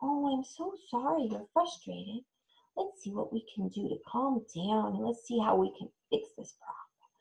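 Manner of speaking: put-on calm a frustrated child gently; soothing. Shown as a demonstration. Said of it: "Kids get frustrated easily".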